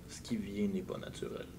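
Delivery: hushed eerie warning; whispered vision voice. Acted. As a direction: whisper it like a spirit. Mysterious melodious voice warns.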